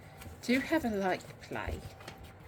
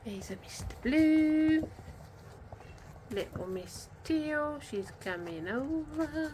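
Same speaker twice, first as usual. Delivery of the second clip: cooing to puppies; 6 semitones higher, 4 dB quieter, slower than usual. Real, not acted. Cooing to puppies, slow high.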